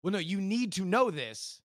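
normal talking voice

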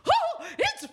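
high, laughing startled cry; startled squeal. Retold or imitated. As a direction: squeal in surprise. Daughter startled by spray.

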